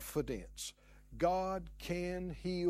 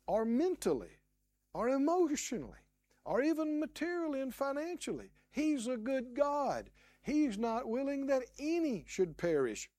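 usual speaking voice

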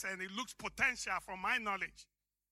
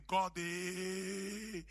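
a lightly put-on African accent, low and soft; half-voiced African accent; 5 semitones lower, 5 dB quieter than usual. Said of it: "my African accent came out".

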